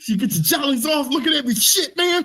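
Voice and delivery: high squeaky dog voice; dog's voice. Pretend. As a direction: complain as an annoyed dog. Voicing the dog.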